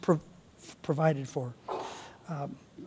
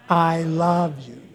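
tender; slower than usual. Shown as a demonstration. Pastor leads congregation in a tender declaration. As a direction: say it like you mean it. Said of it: "Everybody do it".

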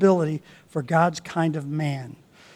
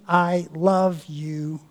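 tender; much slower than usual. Shown as a demonstration. Modelling a tender declaration.

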